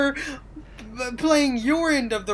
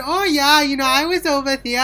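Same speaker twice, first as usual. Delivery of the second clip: louder, higher mock-Swedish accent; playful amateur Swedish accent; attempted Swedish accent; 5 semitones higher, 5 dB louder than usual. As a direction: launch eagerly into a fake Swedish accent. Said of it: "speaking English in a Swedish".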